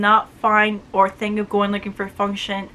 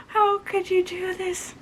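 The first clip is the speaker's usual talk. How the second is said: mock anguish; 8 semitones higher, 5 dB quieter than usual. Real, not acted. Reviewer laments the unresolved ending.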